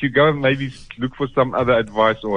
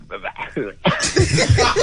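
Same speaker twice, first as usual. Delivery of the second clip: real suppressed laugh; 3 semitones lower than usual. Real, not acted. Shop man struggles to reply to the caller, escaping laughter. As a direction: laugh that escapes despite trying. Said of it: "I'm laughing, but".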